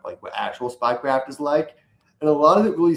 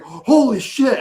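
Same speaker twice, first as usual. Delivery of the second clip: sudden high, loud cry of shock; shocked exclamation; 12 semitones higher, 8 dB louder, much slower than usual. Retold or imitated. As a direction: blurt it out, stunned. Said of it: "he's like".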